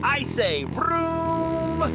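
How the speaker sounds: drawn-out low engine vroom; slow low vroom. Pretend. Vehicle sound imitation.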